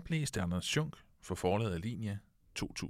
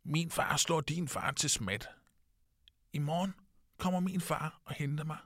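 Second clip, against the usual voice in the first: lightly voiced boy, a bit higher and proud; boastful little boy; 7 semitones higher than usual. A lightly voiced boy bragging to his friend about his father. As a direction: brag like a small boy about his dad.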